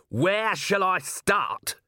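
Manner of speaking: high, drawn-out angry yell; furious narrated outburst. A narrator's reading. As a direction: yell it slowly, pitch well up. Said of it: "he yelled".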